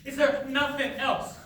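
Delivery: loud, high, exasperated shout; angry outburst. Acted. Son at dinner yells at his mother to stop, angry. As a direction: snap at her to stop.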